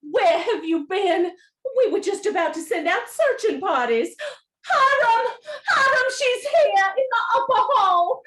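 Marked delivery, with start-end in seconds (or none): 4.7-8.2 higher, louder tearful call. Acted. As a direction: shout the news to her husband. Mother calls husband upstairs.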